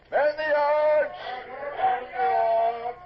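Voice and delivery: slow, drawn-out bellowed command; shouted order. Acted. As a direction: haul the words out across the ship. Officer calls crew to yards.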